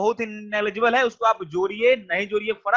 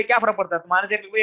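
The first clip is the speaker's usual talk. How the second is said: ordinary delivery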